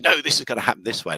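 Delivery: angry commander. Retold or imitated. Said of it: "angry Kirk".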